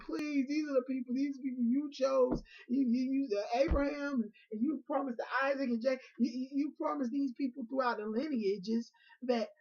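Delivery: high, loud frantic begging; desperate pleading. Retold or imitated. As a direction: beg for the people's lives, voice cracking. Moses begging God for mercy.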